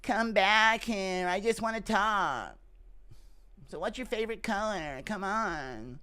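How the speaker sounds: slow, creepy coaxing chase; creepy stalker. Acted. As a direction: lure them back, slowly and creepily. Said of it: "Impression of John Jones trying to hunt you down".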